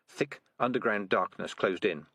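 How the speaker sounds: plain reading voice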